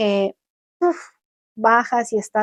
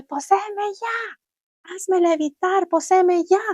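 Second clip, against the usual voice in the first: high, furious shouted demand; shouting at a pillow; 9 semitones higher, slower than usual. Retold or imitated. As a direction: yell it up at the pillow, drawn out at first. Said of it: "empecé a gritarle a mi almohada". (gritando con rabia a la almohada)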